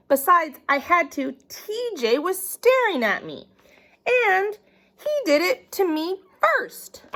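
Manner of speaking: put-on high, pleading child protesting; whiny kid voice. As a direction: whine it like a caught kid. Child whining excuses to mom.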